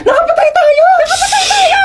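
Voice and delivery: very high-pitched screaming wail; hysterical panic. Acted. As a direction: shriek it in full panic. Hysterics over having killed someone.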